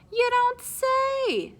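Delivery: high, slow drawn-out fake interest; mock interest. Spyro dodging the subject, slow mock interest. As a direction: drag it out, clearly bored.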